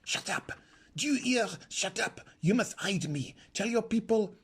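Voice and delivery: hushed, urgent and furious; furious whisper. A narrator's reading. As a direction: hiss it right into her ear. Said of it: "whispered furiously in her ear".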